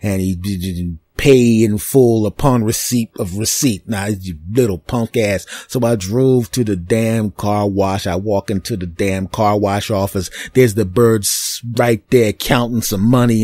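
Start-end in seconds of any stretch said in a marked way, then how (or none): none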